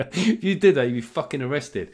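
ordinary talk

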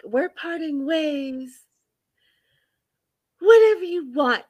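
high, drawn-out wounded voice; Debbie impression. Acting out a woman breaking up with him, high wounded voice. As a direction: play her hurt, high and theatrical. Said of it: "She's like".

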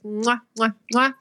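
blown kisses; smacking air kisses; big wet smooch. Real, not acted. Blowing kisses goodbye to listeners.

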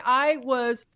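ordinary talk, nothing marked

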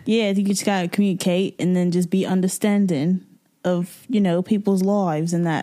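hammed-up British accent; jokey mock-British accent; put-on British accent. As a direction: play it as a Brit for laughs. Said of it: "I'll talk in an accent".

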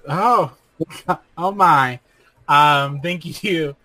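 normal talking voice

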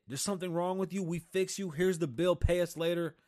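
mock hospital worker, loud and brisk; brisk hospital staff. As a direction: play a hospital clerk handing over the bill.

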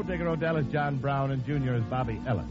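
usual speaking voice

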